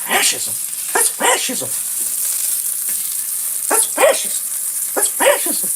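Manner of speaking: high, squeaky mock of hysterical critics; mocking shrill voice. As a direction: parody the screamers in a falsetto. Mocking people screaming fascism.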